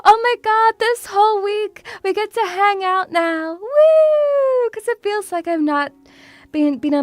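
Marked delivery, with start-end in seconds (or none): 0.0-4.7 high squealed Woo at the end; imitating excited squeal. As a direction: relive the squealing joy. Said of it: "I was squealing so much".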